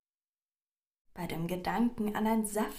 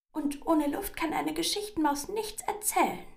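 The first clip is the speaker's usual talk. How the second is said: high, loud little mouse voice; pleading squeaky mouse; 10 semitones higher, 5 dB louder than usual. A narrator's reading. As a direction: bargain urgently as a squeezed mouse. Squeezed mouse bargains for air.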